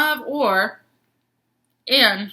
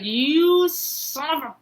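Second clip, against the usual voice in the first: mock frustrated; 8 semitones higher, slower than usual. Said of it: "I was like".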